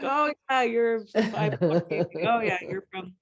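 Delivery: hammed-up laughing recognition; mock recognition. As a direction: tease the familiar faces, amused. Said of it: "I was like".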